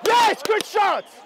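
loud, high, drawn-out approval; real cornerman's yell; shouted encouragement. Real, not acted. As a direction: cheer on the fighter from the corner. Coach cheering his fighter on.

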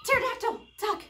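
high, loud warning cry; playful mock-panicked yell; pretend alarm. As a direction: cry out as something swoops overhead. Pretend pterodactyl swooping.

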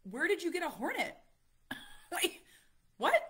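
high, loud disbelieving cry; shocked question. Retold or imitated. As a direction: exclaim it in total disbelief. Retelling shock at child's hornet.

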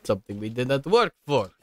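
loud, high mock protest; protesting villagers. Mocking villagers refusing community work, loud and high-pitched. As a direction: whine loudly as work-shy villagers with a Russian accent. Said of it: "they're like".

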